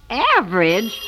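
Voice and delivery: AVERAGE as a stunned rising question; shocked disbelief. Acted. Repeats the grade in disbelief.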